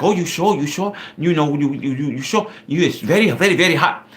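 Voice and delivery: put-on high, hesitant Thai-accented double-checking; mock Thai woman's broken-English warning; imitated Thai accent. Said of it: "I don't know why I sound Chinese".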